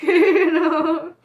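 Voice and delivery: laughing. Real, not acted. Laughs at herself.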